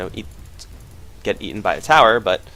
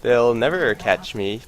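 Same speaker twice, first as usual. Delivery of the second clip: usual speaking voice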